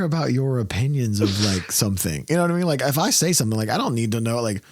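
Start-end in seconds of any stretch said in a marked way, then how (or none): none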